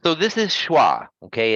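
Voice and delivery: normal talking voice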